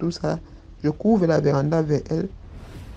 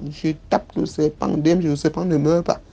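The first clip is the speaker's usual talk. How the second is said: ordinary delivery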